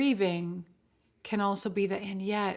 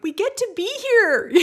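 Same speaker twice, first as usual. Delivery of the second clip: much higher and much louder, gushing and over the top; unbridled enthusiasm; 13 semitones higher, 8 dB louder than usual. Shown as a demonstration. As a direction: belt it out with annoying overexcitement. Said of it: "with that odd intonation".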